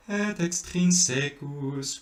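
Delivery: "extrinsecus" sung with the stress on "secus", slow and high; wrong stress. Shown as a demonstration. Teacher showing the mistake to avoid, wrong stress. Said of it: "putting the accent on the secus".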